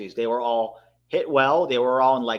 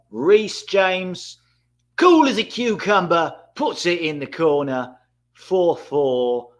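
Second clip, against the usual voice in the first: higher and louder, rising with the shot; live goal call; 4 semitones higher, 6 dB louder, slower than usual. Real, not acted. Streamer calling the kick live, excited. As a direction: call it as it happens.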